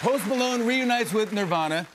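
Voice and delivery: excited. Real, not acted. Repeated with excitement.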